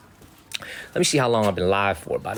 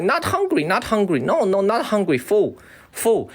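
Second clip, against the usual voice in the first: much higher mock refusal; squeaky, exaggerated brush-off of street food; poking fun at the assistant saying he's full; imitating Harry; 9 semitones higher than usual. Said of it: "He's like".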